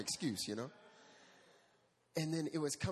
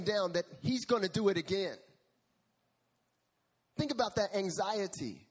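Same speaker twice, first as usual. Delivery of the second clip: louder, higher, driving the point home to the church; a pastor pressing the congregation, louder; preaching at full force; 7 semitones higher, 5 dB louder than usual. Acted. Preacher builds intensity.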